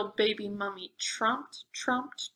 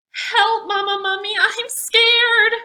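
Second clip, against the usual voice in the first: much higher, loud panicked cry; scared squeal; 11 semitones higher, 10 dB louder than usual. Acted. Little mummy crying for mama, high and panicked. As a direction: squeal for mama in mock terror.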